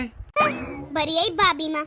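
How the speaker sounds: low contemptuous mutter; wife mocking the sister-in-law behind her back; sneering scoff. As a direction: spit out the title with contempt.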